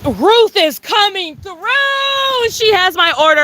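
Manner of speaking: high, slow, drawn-out announcement; excited sing-song. Real, not acted. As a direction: stretch it out like a fanfare. Announcing delivery driver.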